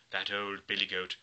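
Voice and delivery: ordinary delivery, nothing marked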